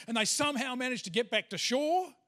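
normal talking voice

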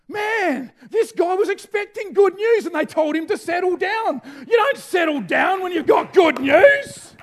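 loud, high, fiery preaching; preaching at full force. Real, not acted. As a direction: drive it home to the church.

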